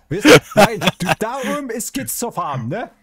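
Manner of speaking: loud, high calling out; loud, high excited call; excited shout. Real, not acted. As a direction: shout it up to the others.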